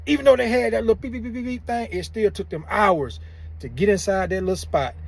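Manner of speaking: ordinary talk, nothing marked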